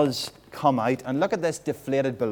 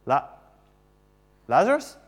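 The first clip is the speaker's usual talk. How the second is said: ordinary talk, nothing marked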